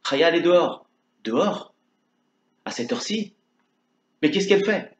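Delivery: surprised. Acted. Le rabbin s'étonne de l'heure.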